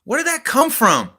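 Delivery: shocked disbelief. Retold or imitated. Son stunned by his mother's nasty reply, shocked disbelief. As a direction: recoil in shock at a sudden insult.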